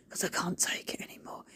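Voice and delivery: hushed, desperate whisper; whispered plea. Pretend. Pleading with the neighbours over their dirty wall, whispered. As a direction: breathe it out like you're at the end of your rope.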